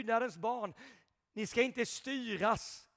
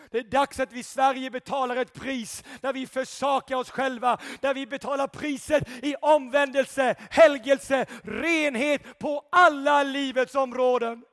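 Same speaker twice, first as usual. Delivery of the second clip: loud, rising sermon delivery, building to shouts; fiery preaching; 3 semitones higher, 7 dB louder than usual. Acted. Preacher rousing the church, shouting praise. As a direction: preach it at full force to the congregation.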